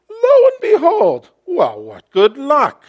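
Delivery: high, loud mock amazement; tongue-in-cheek. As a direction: ham up the wonder, tongue in cheek. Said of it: "But that's tongue-in-cheek".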